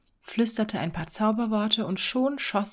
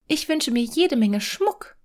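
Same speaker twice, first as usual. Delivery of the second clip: higher, louder dreamy wishing wife; 6 semitones higher, 4 dB louder than usual. Acted. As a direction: wish aloud like a dreaming wife. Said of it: "träumte die Frau vor sich hin". (verträumte Ehefrau)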